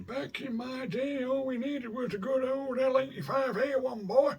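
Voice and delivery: hammed-up American old-timer accent reminiscing; American old man accent. Joking about his old rifle to his viewers, in a mock American old man accent. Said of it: "in an American old man accent".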